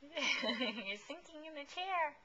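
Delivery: slow, drawn out through a laugh. Real, not acted. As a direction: chuckle as you say it, taking your time. Laughing at baby slumping.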